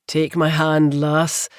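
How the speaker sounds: put-on urging man's voice with a light Scottish accent; a hint of a Scottish brogue, pressing her; the man in the well urges her, lightly Scottish. A narrator's reading. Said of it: "urged in a low, rich voice with a Scottish accent".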